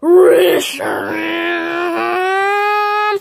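very slow, loud drawn-out roar of the name; long loud dragon roar; monster roar. Pretend. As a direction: roar like a giant dragon appearing. Legendary Pokemon roars its name.

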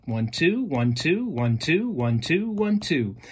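"one, two" repeated, each "two" rising; counting beats. Shown as a demonstration. Teacher counting the five feet of the iceberg's line aloud. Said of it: "it speaks in iambic pentameter".

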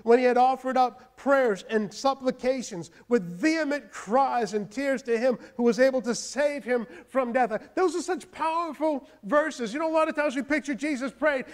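rising pulpit fervour; fired-up preaching. Acted. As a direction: preach it out to the congregation. Preacher builds to full force.

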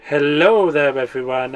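normal talking voice

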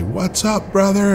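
high, mock-cheery greeting imitating the host; friendly greeting. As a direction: pitch it way up like a buddy saying hi. Retold greeting from lodge host.

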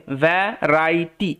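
ভ্যারাইটি split as va, rai, ti; syllable-by-syllable pronunciation. Shown as a demonstration. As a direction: sound out ভ্যারাইটি with small gaps. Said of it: "এরমভাবে উচ্চারণ হবে". (ভ্যারাইটি ভেঙে ভেঙে উচ্চারণ)

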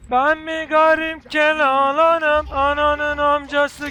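high, slow crazy ranting; wild unhinged ranting. Pretend. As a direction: rant like a madman. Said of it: "Deli taklidi yapacağım".

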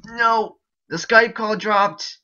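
loud, high, slowed-down outburst; frustrated shout. Real, not acted. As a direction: yell at the dropped call. Call drops mid-game.

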